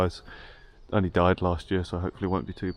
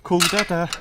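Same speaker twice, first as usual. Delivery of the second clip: higher, louder imitation of a small child; Parker; 9 semitones higher, 5 dB louder than usual. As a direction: lightly mimic a kid asking to phone Dada. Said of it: "Parker's always like".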